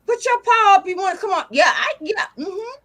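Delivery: ordinary delivery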